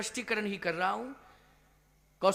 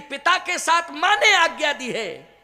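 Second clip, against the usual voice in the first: raised, emphatic address to a son; acted motherly declaration; 6 semitones higher, 8 dB louder than usual. (माँ का ऊँचे स्वर में कथन)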